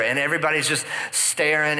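usual speaking voice

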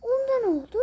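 high, loud mock sobbing; imitating a bawling kid; mock crying kid. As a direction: whine it like a crying child. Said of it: "Diyecek ya".

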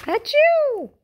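loud, high hammed-up achoo; acted sneeze. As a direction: blast out a big sneeze. Gerald sneezes at Piggie.

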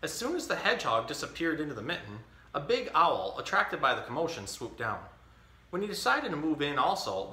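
put-on reading a picture book to a baby; story reading. Dad reading The Mitten to his baby, storytelling. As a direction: read it like story time.

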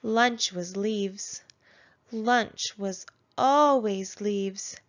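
plain reading voice

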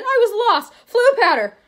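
high, loud outburst; urgent explaining. Acted. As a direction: blurt it out to your rescuer. Lost boy explains to rescuer.

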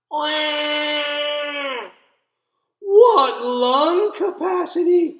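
high, loud and slow with wonder; amazed exclaim. A narrator's reading. Parents marveling at the baby's scream, amazed. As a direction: gasp in delighted amazement. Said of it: "they exclaimed".

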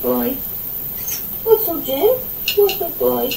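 usual speaking voice

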